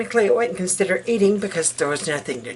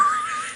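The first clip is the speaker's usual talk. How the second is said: high giggle; 6 dB quieter than usual. Real, not acted. Laugh after joke.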